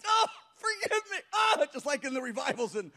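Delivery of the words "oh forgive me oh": imitated high, loud wailing; crying for mercy. Pastor imitates the collapsed man begging Jesus, wailing. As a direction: mimic desperate drawn-out cries of repentance. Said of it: "started crying for mercy".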